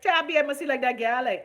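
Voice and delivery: loud, high Bahamian dialect jab; Bahamian dialect aside. Acted. Host teasing a viewer in broad Bahamian dialect.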